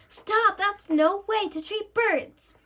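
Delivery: high, loud protest; yelling. Acted. Jill yelling at the monster to free birds. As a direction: shout to stop him hurting the bird. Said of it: "Jill jumps up and yells".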